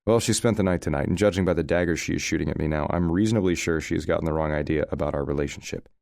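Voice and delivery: usual speaking voice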